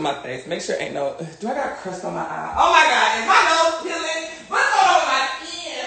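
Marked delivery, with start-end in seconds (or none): 2.6-5.9 high-pitched dismayed squeal; alarmed. Real, not acted. Alarmed at own peeling skin.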